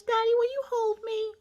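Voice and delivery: put-on very high, pleading child voice; imitated little girl. Girl asking daddy to hold her, imitated high voice.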